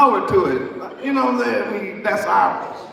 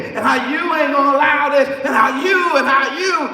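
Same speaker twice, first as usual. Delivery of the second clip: preaching at full force; 4 semitones higher, faster than usual. Acted. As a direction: hammer the congregation with it.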